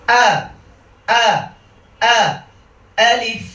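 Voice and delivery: short, snapped "আ" from the throat, then "আলিফ"; staccato glottal "আ", clean "আলিফ"; crisp, from the throat. Shown as a demonstration. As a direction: hit each "আ" hard and high, then "আলিফ". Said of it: "গলা থেকে এনে টাস টাস করে বলতে হবে".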